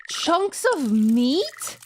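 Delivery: slow disbelieving gasp of a question; horrified. Acted. Player reading horror text, aghast. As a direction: recoil at what's in the sink.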